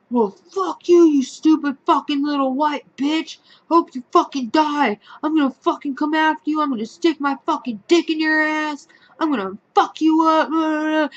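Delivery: high, loud mock tirade; ranting angry man. Patient threatening her after her sigh, furious ranting.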